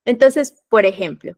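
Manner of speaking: ordinary talk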